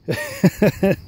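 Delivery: short amused laugh; a quick real chuckle. Real, not acted. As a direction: laugh at your own idea. Laughing at own joke.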